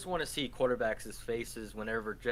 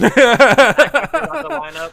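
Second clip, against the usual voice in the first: laughing; 9 semitones higher, 21 dB louder, much slower than usual. Real, not acted. Laughing at joke about quarterbacks.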